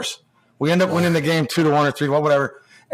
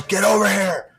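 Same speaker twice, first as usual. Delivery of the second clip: retold yell; 5 semitones higher than usual. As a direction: bark it across the ice. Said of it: "he yells".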